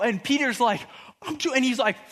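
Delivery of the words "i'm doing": high, excited mock panic; freaking out. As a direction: lightly play someone overwhelmed with excitement. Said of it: "freaking out".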